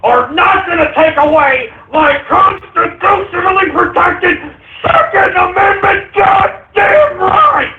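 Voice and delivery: a host screaming defiance at liberals; furious rant. Acted. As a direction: shout them down, all fury. Gun-rights rant at liberals.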